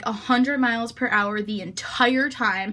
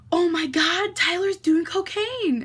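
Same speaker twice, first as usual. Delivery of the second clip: high, drawn-out shocked gasp of a quote; mock alarm; 7 semitones higher, slower than usual. Rebecca spots cocaine in the kitchen, mock alarm. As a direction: imitate a friend's scandalised alarm.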